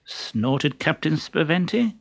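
plain reading voice

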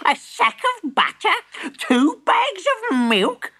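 high, slow scolding granny; cross old granny. A narrator's reading.

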